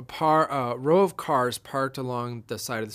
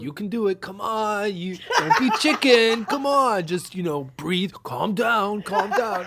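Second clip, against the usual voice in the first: high, loud cajoling cop, laughing through it; mock coaxing cop; 8 semitones higher, 8 dB louder, slower than usual. Cop urging nervous friend to drive, laughing mock voice. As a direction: coax a scared driver, pitched way up.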